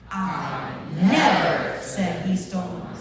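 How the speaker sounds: never punched loud, in a slow line; stress on never. Shown as a demonstration. Group practicing one sentence together, stress on never. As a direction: hit the word never hard. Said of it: "Let's try never".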